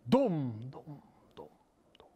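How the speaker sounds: word echoing away into near silence; fading echo. A narrator's reading. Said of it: "klonk als een echo in de sneeuw".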